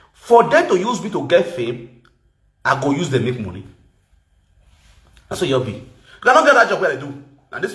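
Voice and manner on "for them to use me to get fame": usual speaking voice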